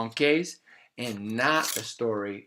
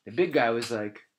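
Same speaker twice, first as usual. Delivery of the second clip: ordinary delivery, nothing marked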